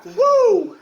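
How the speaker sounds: high loud whoop; excited whoop. Real, not acted. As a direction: let out a sharp high whoop. Hot pepper challenge reaction.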